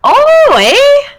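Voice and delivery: very high, squeaky hammed-up Canadian eh. Joking about Canadian stereotypes.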